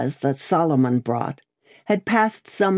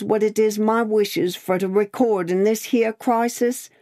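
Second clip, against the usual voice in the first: higher, earnest Cockney speechifying; solemn Cockney Cruncher; 6 semitones higher than usual. A narrator's reading. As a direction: make a ponderous vow in lightly voiced Cockney.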